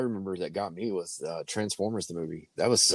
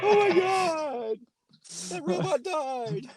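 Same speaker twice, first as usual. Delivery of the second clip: shocked; much slower than usual. Retold or imitated.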